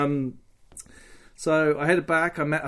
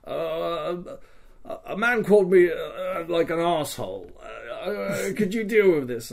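stammering, higher-pitched plea; hesitant complaint; 3 semitones higher than usual. Retold or imitated. Ruben asks Mister Jones to deal with an insult, hesitant and stammering. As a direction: falter through an awkward request for help.

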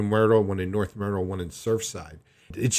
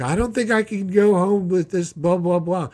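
higher, louder put-on whine of the mother; whiny mom; 9 semitones higher, 7 dB louder than usual. Mom pleading with the doctor to stay, mock whiny. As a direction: mimic her pleading to stay.

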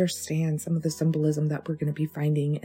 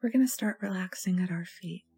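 soft meditation voice with long pauses; guided meditation; 6 dB quieter than usual. Acted. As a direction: gently guide them into relaxation, leaving space to breathe.

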